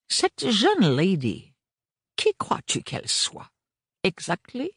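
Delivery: high, slow, dawning amazement; stupefied question. A narrator's reading. Lazarus asking his son who the girl is, amazed. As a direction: slowly realise who she is. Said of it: "avec une expression de plus en plus stupéfaite".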